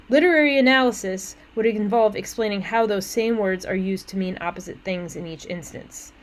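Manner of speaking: normal talking voice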